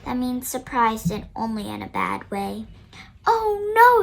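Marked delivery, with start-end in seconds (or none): 3.2-4.0 high, loud alarmed "Oh no"; teacher realizing she forgot her fancy things; dismayed cry. Acted. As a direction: cry out in sudden panic. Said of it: "she cries".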